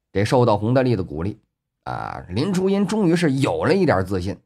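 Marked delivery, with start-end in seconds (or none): none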